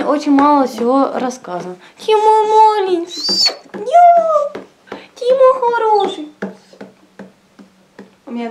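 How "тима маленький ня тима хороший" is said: squeaky praising a dog. Real, not acted. Cooing baby talk to dog.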